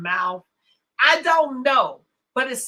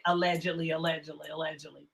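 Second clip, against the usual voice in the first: low, fading aside, softer each time; muttered disclaimer; 8 semitones lower, 9 dB quieter, faster than usual. Real, not acted. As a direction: drop low and trail away. Hedging a risky claim.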